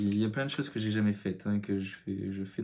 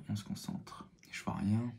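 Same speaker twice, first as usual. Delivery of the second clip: focused whisper; 6 dB quieter than usual. Real, not acted. Steadying himself mid-makeup, whispered. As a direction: whisper while concentrating hard. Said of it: "On se calme".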